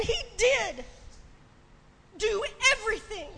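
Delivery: high, loud, crying preacher's climax; fervent preaching. Real, not acted. Preacher driving home Christ's sacrifice to the congregation, impassioned. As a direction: preach it with tears and fire.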